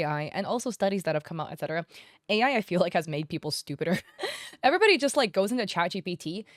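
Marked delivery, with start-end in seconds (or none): 4.1-4.6 short unvoiced high laugh; a quick airy giggle; breathy laugh. Real, not acted. Vlogger giggling after calling AI users stupider, breathy laugh.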